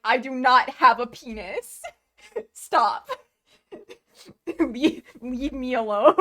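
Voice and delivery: loud, high mock indignation breaking into laughs; Kibo. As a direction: imitate an offended robot, laughing through it. And Kibo's like.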